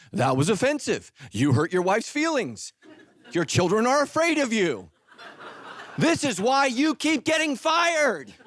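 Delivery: scolding detector. Retold or imitated. Imagined blind-spot detector scolding.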